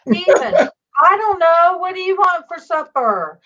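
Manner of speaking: calling out loud. Retold or imitated. Calling out to Ma while cleaning the pool. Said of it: "I'm saying all this loud".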